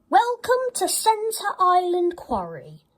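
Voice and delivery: high, bright announcement; announcing. A narrator's reading. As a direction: announce it grandly. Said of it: "she announced".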